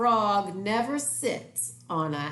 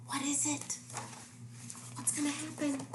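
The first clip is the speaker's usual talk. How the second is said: high hushed suspense; 9 semitones higher, 9 dB quieter, faster than usual. Acted. Suspense in picture book reading.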